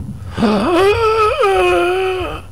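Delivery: startled, high-pitched gasp; sharp high gasp. Real, not acted. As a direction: gasp in fright.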